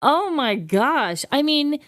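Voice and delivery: ordinary delivery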